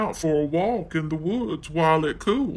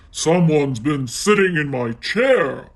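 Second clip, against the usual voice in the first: loud growling Daddy Bear; Daddy Bear growls about his chair; growled; 6 dB louder than usual. Acted. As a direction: growl it like a big angry bear. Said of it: "he growled".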